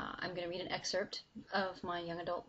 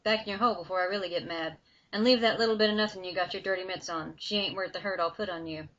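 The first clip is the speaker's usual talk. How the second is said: louder and fast, a threat with a grin; warning; 8 dB louder, faster than usual. Acted. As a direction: fire the threat quickly at the demon. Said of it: "Billy warned".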